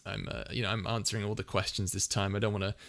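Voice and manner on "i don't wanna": ordinary delivery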